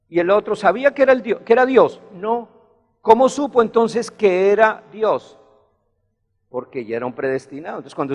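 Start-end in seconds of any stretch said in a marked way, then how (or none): none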